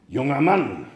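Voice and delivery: lightly voiced wolf's call. A narrator's reading. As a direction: hail a passer-by, mildly. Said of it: "rief der Wolf".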